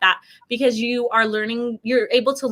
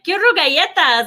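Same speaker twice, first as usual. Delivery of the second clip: Spanish order; 6 semitones higher, 5 dB louder than usual. Retold or imitated. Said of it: "I'm like".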